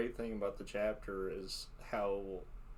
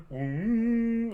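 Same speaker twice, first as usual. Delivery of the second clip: high hum; 13 semitones higher, 9 dB louder than usual. Retold or imitated. Imitating the ears sound.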